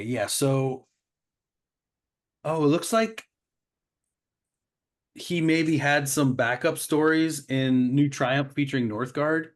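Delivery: ordinary talk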